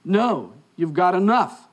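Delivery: slow, emphatic no; retold waitress laying down the law slowly; firm refusal. Waitress refusing syrup to a customer, firm.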